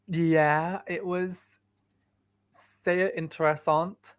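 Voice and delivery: slow "sehr interessant" with French vowels; "sehr interessant" in a French-tinged German; German accent. Shown as a demonstration. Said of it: "That was supposed to be German".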